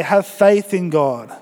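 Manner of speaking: slow, weighty "Have faith in God"; slow call to repeat. Shown as a demonstration. Pastor having the church repeat the verse, slow and weighty. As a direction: lead them line by line, slowly. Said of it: "Why don't you say".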